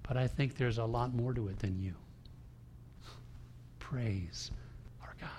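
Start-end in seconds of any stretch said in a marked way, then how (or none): 3.8-5.3 breathy hushed praise to God; soft reverent whisper. Real, not acted. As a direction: whisper it in awe. Awed aside in sermon.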